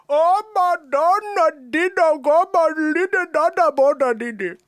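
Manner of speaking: long, loud, high Indian-accent wail; all-over Indian accent. Shown as a demonstration. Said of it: "You could go all over with that one".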